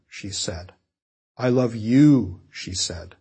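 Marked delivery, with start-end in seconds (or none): 1.4-2.4 slow, landing hard on a drawn-out "you"; "you" stretched and emphasized at the end; stress on YOU. Shown as a demonstration. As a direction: save the weight for the final "you". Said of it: "to show the speaker's emphasis".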